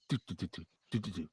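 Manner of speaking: very soft low hummed do do do; barely audible bass hum; hummed bass line. Shown as a demonstration. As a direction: hum the bass line under your breath. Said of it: "You're gonna hear it go".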